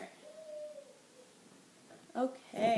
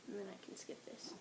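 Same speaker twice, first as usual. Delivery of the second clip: whispered aside to herself; whispering; 5 semitones lower, 16 dB quieter, faster than usual. Real, not acted. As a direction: drop to a private whisper. Aside to herself mid-lesson.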